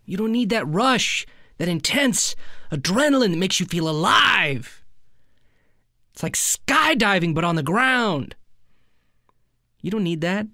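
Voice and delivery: building relish for the rush; mock exhilaration. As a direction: swoop up and stretch the thrilling words. Savouring the thrill of shoplifting.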